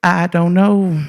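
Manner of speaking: slow, drawn-out talking horse. Acted. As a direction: stretch it out like Mister Ed answering. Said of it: "that horse turned around and said".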